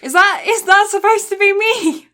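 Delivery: loud, high-pitched incredulous question; amused disbelief. Real, not acted. Co-host hears themselves being imitated, amused disbelief. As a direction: call out the bad impression of you.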